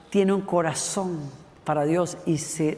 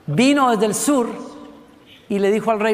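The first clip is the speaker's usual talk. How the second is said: normal talking voice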